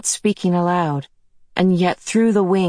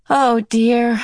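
sighing; 7 semitones higher, 4 dB louder than usual. Acted. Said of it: "Meg sighed".

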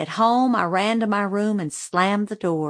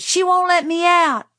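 loud, high-pitched tattling shout; 10 semitones higher, 6 dB louder than usual. A narrator's reading. Boy telling Grandma he is trapped, shouting. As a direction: holler the accusation like an aggrieved child. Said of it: "shouted Francis".

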